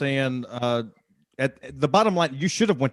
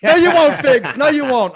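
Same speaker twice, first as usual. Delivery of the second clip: much higher and louder, laughing through it; laughing protest; 13 semitones higher, 11 dB louder than usual. Real, not acted. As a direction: hoot it out, refusing through laughter. Laughing rejection of the retraction.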